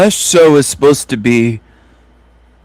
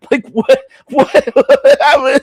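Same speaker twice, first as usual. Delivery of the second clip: laughing in disbelief; 10 semitones higher than usual. Real, not acted. Laughing at clip.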